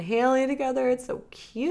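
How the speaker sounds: gushing. Real, not acted. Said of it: "gushing take".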